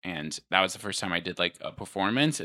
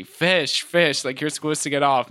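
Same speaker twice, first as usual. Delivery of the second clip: loud mock yell of an impatient crowd; retold audience yelling; 7 semitones higher, 7 dB louder than usual. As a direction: shout it like a heckling audience. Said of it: "yelling at me".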